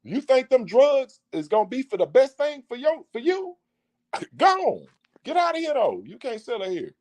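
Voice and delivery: high, incredulous parental scolding; scolding God. Retold or imitated. God scolding a drug-selling child, high and incredulous. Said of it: "that's how God be like".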